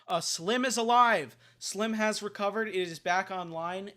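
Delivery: loud, high, thrilled announcement; excited. Real, not acted. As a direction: burst out with the good news. Announcing happy news mid-debate.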